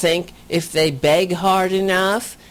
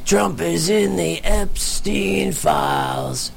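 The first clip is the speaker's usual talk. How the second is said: slow gravelly sung impression; slower than usual. Troll singer taunting a rally crowd, deep gravelly singing impression. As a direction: sing it drawn out, imitating a deep-voiced troll. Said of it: "very deep and gravelly voice".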